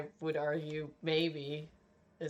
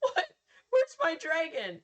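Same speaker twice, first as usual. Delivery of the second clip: very high, indignant squeak; whiny disbelief; 12 semitones higher, 5 dB louder than usual. Retold or imitated. Gamer demanding the promised dragon, whiny squeak. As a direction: demand your dragon like a cheated kid. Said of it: "And I was like".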